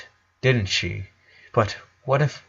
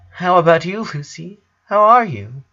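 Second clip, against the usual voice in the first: high voice; 7 semitones higher, 3 dB louder than usual. A narrator's reading. Brother asks sister.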